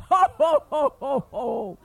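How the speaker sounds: booming ho ho laugh fading; big laugh dying away; giant laugh. Acted. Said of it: "How did the giant laugh?".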